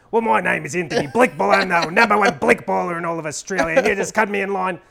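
put-on loud, high, terrible Australian accent; Blackball. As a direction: announce yourself in a hammed-up Aussie accent. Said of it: "a terrible Australian accent".

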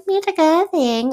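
annoyed mimicry. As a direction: echo it back, fed up and teasing. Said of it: "Like, just so annoyed".

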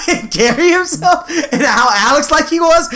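giggling while describing him; laughing. Real, not acted.

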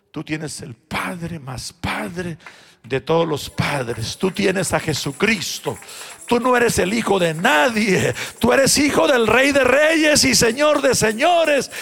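grandmother's words, preached loud and slow; preached blessing. Acted. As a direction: proclaim it like a promise.

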